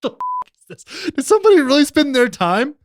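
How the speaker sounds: high, disbelieving question; a hammed-up squeak of disbelief at "Did somebody really spend their time?"; incredulous. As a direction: sound stunned that somebody did this. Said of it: "repeats the line differently".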